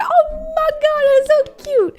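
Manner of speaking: very high, drawn-out gushing squeal; slow, squeaky adoring gush; childlike squeal. Acted. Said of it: "I'm such a child".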